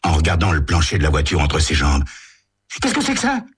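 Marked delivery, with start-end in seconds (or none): 2.8-3.5 put-on high, alarmed teen voice; startled by something at your feet; teen driver frightened by object on floor, high voice; panicked. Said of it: "affolé".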